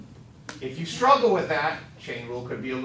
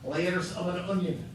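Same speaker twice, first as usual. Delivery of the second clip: Scottish accent. Shown as a demonstration. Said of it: "You have to do it with a Scottish accent".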